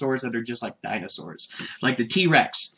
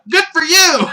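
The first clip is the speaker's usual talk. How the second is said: high, loud, beaming cheer; bright loud kid praise; cheerful Goku; 14 semitones higher, 8 dB louder than usual. Retold or imitated. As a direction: congratulate her with total innocence. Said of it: "Goku's just like".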